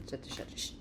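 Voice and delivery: whispered stammering shush. Acted. Queen silencing everyone, in a whisper. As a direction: hiss it under your breath.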